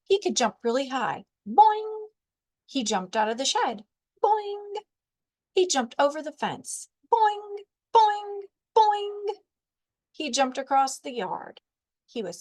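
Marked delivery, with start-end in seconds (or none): 1.5-2.1 high springy boing; cartoon spring boing. A narrator's reading. Spring jump sound effect. 4.2-4.7 high springy boing; cartoon spring boing. A narrator's reading. Spring jump sound effect. 7.1-9.4 high springy boing; cartoon spring boing. A narrator's reading. Spring jump sound effect.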